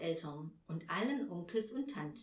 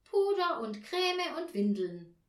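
put-on high, louder little girl; child Tina; 7 semitones higher, 7 dB louder than usual. Girl adds more wishes for her doll, high and eager.

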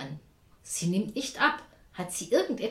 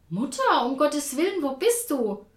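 frantic relieved cry; 7 semitones higher, 6 dB louder than usual. A narrator's reading. Daughter reaching lost mother, relief.

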